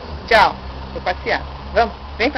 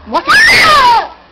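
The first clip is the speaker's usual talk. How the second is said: high, slow, drawn-out coaxing call; sing-song coaxing; 11 semitones higher, 8 dB louder, much slower than usual. Real, not acted. Grandma coaxing toddler to walk.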